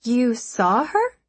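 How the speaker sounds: slow, high, stunned question; shocked disbelief. A narrator's reading. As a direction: ask it in disbelief. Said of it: "the shock and incredulity in Ani's voice".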